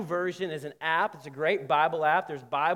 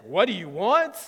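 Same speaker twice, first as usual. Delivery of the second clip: louder, blunt challenge with rising end; demanding question; 2 semitones higher, 6 dB louder than usual. Shown as a demonstration. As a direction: demand an answer, with force on WANT. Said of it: "if he said like this".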